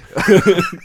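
high, loud burst of laughter; real laughter at her mistake. Real, not acted. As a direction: cracks up at her own slip. Laughs at accent slip.

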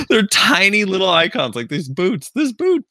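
high-pitched incredulous squeal over something tiny; amused exasperation. Real, not acted. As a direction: pitch shooting way up in giddy disbelief. Amused outburst over tiny icons.

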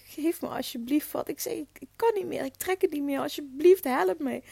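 high, strained pleading in mock despair; desperate begging. Retold to the midwife, desperate high begging for relief. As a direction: beg for help as if at the end of your rope. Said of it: "ik was zo ten einde raad".

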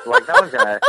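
high laughing delivery; amused and giggling. Real, not acted. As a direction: squeak it out through laughter. Laughs at own joke.

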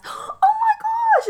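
gasped, very high, drawn-out exclamation; shocked class reaction. Retold or imitated. Class hears teacher is pregnant, high gasping shock. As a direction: imitate a class erupting at the news. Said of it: "it just kinda crescendo through the class".

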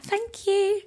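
tiny falsetto thank-you; very coy thanks. Retold or imitated. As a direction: squeak it sweetly, way up high. Repeats her coy thanks, higher.

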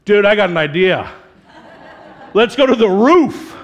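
loud, laughing dude pitching a wild idea; a buddy hyped on a dumb plan; excited buddy. Retold or imitated. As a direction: college friend loudly pitching going up on the roof. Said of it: "friends back in the day that were like".

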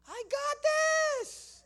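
very high, slow, piercing yell; mock warrior scream. Warrior woman volunteering to help, mock warrior scream. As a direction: imitate a woman charging in with a long scream. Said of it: "She said".

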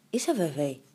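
ordinary delivery

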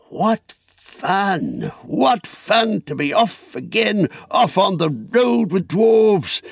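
high, slow, relished delight; gleeful exclamation. Acted. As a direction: revel in being off on an adventure. Bilbo rejoices at setting off.

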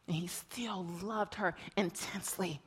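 hushed whisper of his love. Acted. Preacher whispering tenderly to the congregation. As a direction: breathe it softly as a secret.

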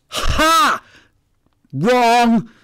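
triumphant scoff. Retold or imitated. Client scoffing at the palm reader's wrong guess, loud gloating shout. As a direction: crow it like you caught her out.

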